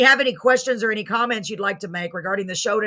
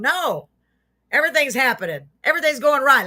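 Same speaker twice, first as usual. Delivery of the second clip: higher, brighter-pitched rebuttal; upbeat positive; 5 semitones higher, 5 dB louder than usual. Acted. Host urges viewers to think positive, upbeat correction. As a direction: counter the gloom with lifted, emphatic cheer. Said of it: "Let's think positive".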